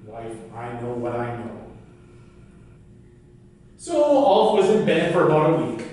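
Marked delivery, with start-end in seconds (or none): 0.1-1.5 lower, softer, dry refusal; stubborn Ulf. Acted. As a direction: keep it low and closed, giving nothing away. Ulf answers his wife stubbornly.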